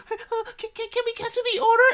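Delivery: put-on very high, stammering little-kid voice; imitated timid children, hesitant and high; freaked-out kids. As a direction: squeak it out like nervous kids. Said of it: "I think they got freaked out".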